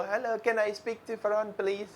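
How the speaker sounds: imitated Chinese-accented English, drawn out; slow phone-call English in a Chinese accent. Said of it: "like they used to say like this".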